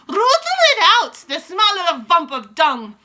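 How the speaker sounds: very high, loud screech. Acted. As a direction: screech the hunt order. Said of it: "screeched the Grand High Witch".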